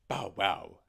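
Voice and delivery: loud bow-wow bark; barking dog. Acted. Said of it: "said the dog".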